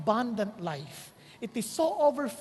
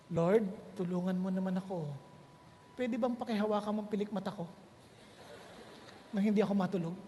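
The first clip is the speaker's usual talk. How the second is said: soft, lower pleading prayer to God; mock sleepy prayer; 6 semitones lower, 7 dB quieter, faster than usual. Preacher mock-praying to stay awake, soft and low. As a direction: beg God half-asleep, quietly.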